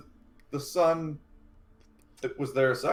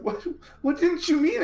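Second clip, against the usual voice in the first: put-on high-pitched upset child; about to cry. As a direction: ask it high, close to tears. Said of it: "starts to cry".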